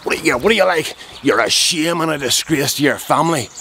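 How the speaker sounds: mildly shouted, high-pitched scolding; imitated shouting. Attacker shaming him about his family, mildly shouted. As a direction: act out an angry telling-off. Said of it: "this boy started shouting".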